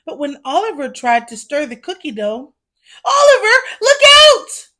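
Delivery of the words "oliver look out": high, loud warning shout. Acted. Elf warning Oliver about the mixing bowl, shouting. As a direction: yell a warning across the bakery. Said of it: "one of the elves shouted".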